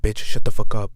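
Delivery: loud angry yell at the guy ahead; retold yell. Yelling at a guy blocking the smoke-filled bus aisle, loud furious shout. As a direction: yell it at him, fed up and furious. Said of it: "I yelled at him".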